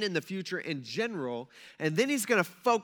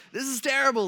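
high, louder panicky complaint; mock freak-out; 8 semitones higher, 5 dB louder than usual. News watcher reacting to a natural disaster, mock panic. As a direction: imitate someone starting to panic. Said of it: "you start kinda freaking out".

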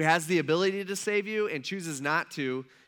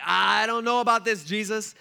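higher, louder balking disciple; drawn-in, raised-voice objection; reluctant protest; 3 semitones higher, 6 dB louder than usual. Retold or imitated. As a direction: voice a hesitant follower pushing back. Disciples voiced balking at Jesus's plan.

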